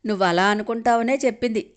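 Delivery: ordinary delivery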